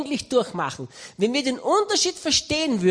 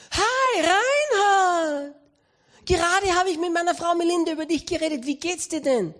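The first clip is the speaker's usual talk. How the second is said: much higher, cheerful greeting; cheery Bill Gates; 6 semitones higher than usual. Retold or imitated. Bill Gates greeting Reinhard cheerfully on the phone. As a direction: sing out a bright hello.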